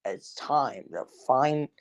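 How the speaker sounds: haunting narrator imitation, trailing unsurely; Paul's vids impression. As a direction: attempt a dark narrator, drawn out and low. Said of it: "I don't know how to say it".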